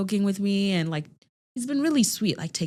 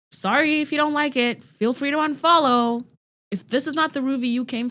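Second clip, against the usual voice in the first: sarcastic defiance; 8 semitones higher, 4 dB louder than usual. Retold or imitated. Defiant retort to a hater.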